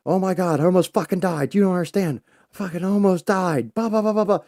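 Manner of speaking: frantic high loud babble; panicked rant. Retold or imitated. Telling son about the crash, high frantic panic. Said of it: "And I'm going off".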